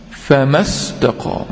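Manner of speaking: "فما استقام" run together as famas-taqaam, alif dropped; linked reading, madd dropped. Shown as a demonstration. Teacher showing students the linked reading of "فما استقام", famas-taqaam, madd dropped. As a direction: slowly join "فما استقام" with a short a straight into the s. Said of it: "نحذف حرف المد لكلمة فما".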